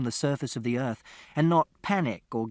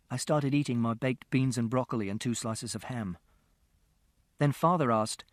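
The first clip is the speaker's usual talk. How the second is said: ordinary delivery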